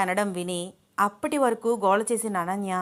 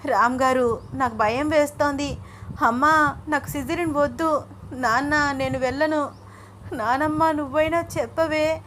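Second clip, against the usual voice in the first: high, plaintive mock crying; narrated wife crying in fear; tearful pleading; 5 semitones higher than usual. A narrator's reading. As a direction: plead with each relative in a raised wail. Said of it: "అంటూ ఒకటే ఏడుస్తోంది".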